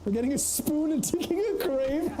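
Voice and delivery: said through high laughter; high, laughing voice. Real, not acted. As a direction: giggle the words out. Laughing while joking.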